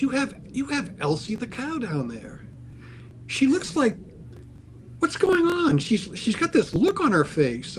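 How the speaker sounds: high-pitched, animated wonder; acted-out excited curiosity, voice way up; retold amazement. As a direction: imitate someone delightedly puzzled, pitch climbing. Retold animator reacting to the print.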